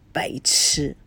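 soft, unvoiced whisper of contempt; whispering an insult at the TV. A narrator's reading. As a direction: breathe the insult under your breath. Muttered insult at TV.

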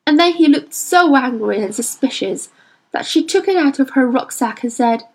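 ordinary delivery, nothing marked

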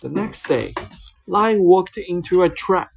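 ordinary delivery, nothing marked

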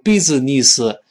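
business as three syllables, bi-zi-ni-si; mispronounced business, every consonant given a vowel; wrong pronunciation. Shown as a demonstration. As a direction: say business with long ee and an added vowel after each s. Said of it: "注意不要读business".

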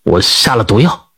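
low, flat-voiced cold confession. A narrator's reading. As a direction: say it coldly and low, no feeling. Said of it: "冷声的就说".

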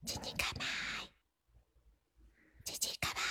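soft breathy whisper. Real, not acted. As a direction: whisper the nudge under your breath. Coaxing the shy player quietly.

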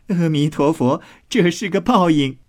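high, lightly gloating maid's voice. A narrator's reading. Maid calling the mishap karma, high and gloating. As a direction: pronounce it high, with playful satisfaction.

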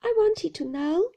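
timid, high-pitched plea; frightened young customer speaking up; trembling. A narrator's reading. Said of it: "I said, trembling".

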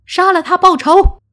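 very high, loud rallying cry; vengeful shouting. Acted. Villager echoing the mob's call for revenge, shouting high. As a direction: chant it loudly to rouse the mob. Another echoes the call to kill.

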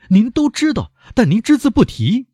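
very high, frantic accusation; high, fearful outcry; terrified cry. A narrator's reading. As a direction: accuse in a panic, pitch right up. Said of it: "莫雷尔高声地说， 处在极度的恐惧之中".

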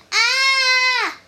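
high, loud scared shriek; frightened shriek. Acted. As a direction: let out a terrified "ah". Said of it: "the guards shrieked".

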